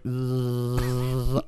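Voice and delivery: soft drawn-out zombie drone; dazed buzzing. Retold or imitated. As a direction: buzz softly through a haze. Imitates being in a blur.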